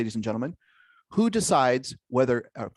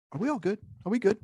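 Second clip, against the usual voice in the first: checking in; 10 semitones higher, faster than usual. Retold or imitated. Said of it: "a validation of".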